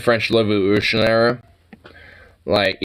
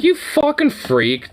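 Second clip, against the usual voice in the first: pitched way up in disbelief; incredulous high outburst; high outraged exclaim. Real, not acted. Outraged at Musk.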